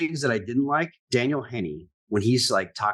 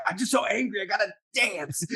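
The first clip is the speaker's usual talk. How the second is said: high-pitched hammed-up angst; mock angry dancer; 8 semitones higher than usual. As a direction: imitate a comically overwrought dancer. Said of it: "Flight of the Conchords too? Where he's like".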